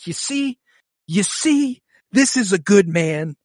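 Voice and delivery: high, loud, insistent vindication; emphatic vindication. Retold or imitated. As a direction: drive the point home, high and forceful. Acting out own vindicated reaction to a scene.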